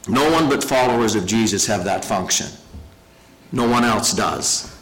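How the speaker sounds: usual speaking voice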